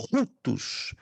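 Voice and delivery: "rutus" with a strong breathy h: HHOO-tus; aspirated "rutus", the r sounded as h; rough breathing. Shown as a demonstration. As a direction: push air out before the u of "rutus". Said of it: "porque tem aspiração áspera".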